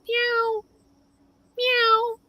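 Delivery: thin, high-pitched imitated meow; cat meow. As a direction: mimic a distant cat's mew. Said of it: "and we hear".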